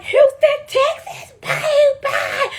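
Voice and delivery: high, hyped, laughing hometown call; hyped shout. Retold or imitated. Contestant hyping her hometown to the host, high laughing shout.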